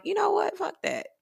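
sudden high, hushed inner voice. Retold or imitated. To herself, deciding to stop holding back: high, hushed inner voice. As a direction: let the thought slip out high and quiet. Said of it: "something in the back of my mind was like".

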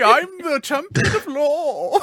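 mock pompous accent. Mocking herself for chat, in a hammed-up pompous accent. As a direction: ham up a grand self-important hero voice. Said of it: "I say things in that accent".

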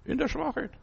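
echoed softly. Acted. Preacher echoes phrase, soft high.

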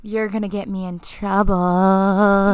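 slow, drawn-out TROUBLE; mock flirty guy. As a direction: drag out "trouble" like a hammed-up come-on. Mimicking unfaithful guy's favorite line.

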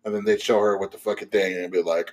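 ordinary talk

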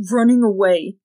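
put-on boy taunting a fleeing adult with a sneer. A narrator's reading. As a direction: spit it out with contempt. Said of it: "Jordan said with a sneer".

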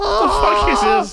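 high, drawn-out wail of disbelief; slow, whining cry of outrage; mock outraged cry. Friend mocking the Tesla crash, a high disbelieving wail.

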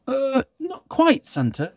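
put-on high little old elf voice; hesitant little elf; christmas elf. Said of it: "said the little old elf".

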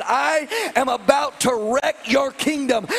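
shouting down the devil, full preaching force; defiant shout at the devil. Retold or imitated. Preacher defying the devil, loud and fired up. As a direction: hurl it at the devil like a challenge. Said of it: "And I told the devil".

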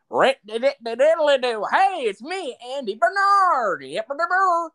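high, loud Andy voice; Andy Bernard impression. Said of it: "I can do Andy".

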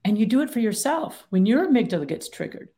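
ordinary talk, nothing marked

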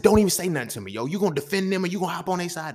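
loud, high-pitched retort at mom; angry cussing. Retold or imitated. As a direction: cut her off in heated protest. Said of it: "I'm cussing in her face".

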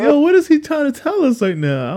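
very high, bewildered questioning; mad confused. Retold or imitated. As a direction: pitch it way up, utterly lost.

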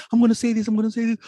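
a hint of a nervous teacher stalling; imitating teacher. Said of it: "a teacher in class who was trying to s-, pronounce your name".

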